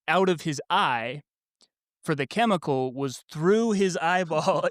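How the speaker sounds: ordinary delivery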